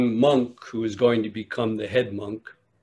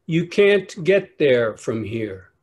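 "You can't get there from here" drawn out slow and deadpan; slow country boy answer; 3 semitones higher, 4 dB louder than usual. Acted. Rustic boy answers the lost monk slowly, deadpan. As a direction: take your time, matter-of-fact like a backwoods kid.

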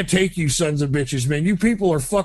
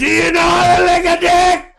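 much higher, screaming the question; shrieked repeat; 12 semitones higher, 4 dB louder than usual. Acted. Caller shrieking his crude question at the Spanish speaker. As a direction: scream it up high in disbelief.

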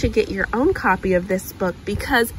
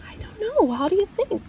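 put-on reading to a child. Parent reading a picture book aloud, gentle storytelling. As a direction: read it like a picture book for a little one.

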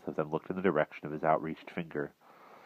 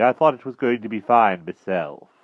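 higher, louder uneasy small talk; nervous; 5 semitones higher, 8 dB louder than usual. A narrator's reading. King misreads the greeting as weather, nervous. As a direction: protest mildly and nervously. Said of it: "looking at him nervously".

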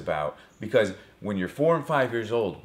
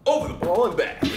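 louder, higher, with a push; excited; 9 semitones higher, 3 dB louder, faster than usual. Shown as a demonstration. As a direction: go again, with more energy. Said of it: "One more time".

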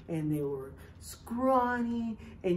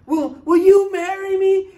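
loud eager proposal; 10 semitones higher, 12 dB louder than usual. Retold or imitated. Young man proposing marriage.

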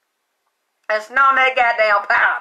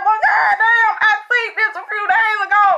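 amazed exclamation; 9 semitones higher than usual. Retold or imitated. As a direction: cry out in amazement, words tumbling fast. Retold exclamation on seeing it.

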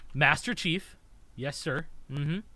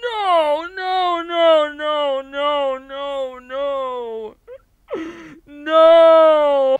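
louder, higher anguished no; alarmed cry; 5 semitones higher, 3 dB louder, much slower than usual. Real, not acted. Gamer sees the singer about to jump, crying out. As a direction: cry out in mock horror.

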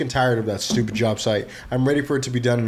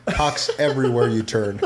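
ordinary talk

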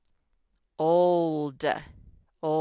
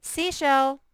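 Seashell called out high and loud; loud announcement; 8 semitones higher, 5 dB louder than usual. Shown as a demonstration. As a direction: proclaim Seashell to the class. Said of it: "It's a compound word".

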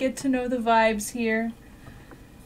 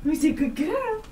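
higher sing-song praise; squeaky praising a dog; 5 semitones higher than usual. Real, not acted.

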